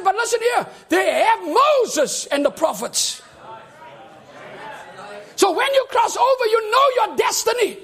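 loud, high shouting preacher; preaching. Acted. Preacher shouting to the brethren about the rich man. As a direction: press the point home.

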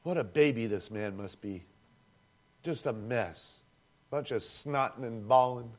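low, dry mock scorn; sarcastic mockery. Preacher mimicking judgmental churchgoers, in dry sarcastic scorn. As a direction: sneer like a smug churchgoer.

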